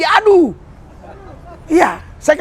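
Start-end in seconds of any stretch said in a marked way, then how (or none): 0.0-0.5 high mock moan aduh; imitating a patient's groan; imitated groan. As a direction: slip back into a groan, pitched up. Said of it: "Oh iya, Pak, Yesus".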